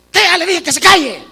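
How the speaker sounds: put-on high, loud angry yell at the kid; parent yelling. Angry parent yelling at a noisy child to shut up. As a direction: shout him down like an exasperated parent. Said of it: "gritarles a nuestros hijos".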